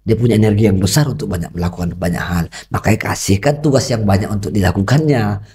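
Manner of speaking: ordinary talk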